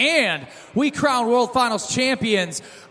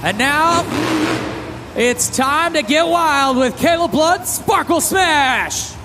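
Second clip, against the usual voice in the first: hype announcer; 5 semitones higher than usual. Real, not acted. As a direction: stretch the truck name out. Hype truck introduction.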